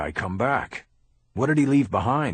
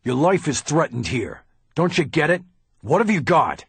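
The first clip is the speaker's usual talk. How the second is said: loud, much higher hammering questions; heated pressing; 7 semitones higher, 6 dB louder than usual. Acted. Bosch grilling the lawyer, loud and angry. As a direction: corner him.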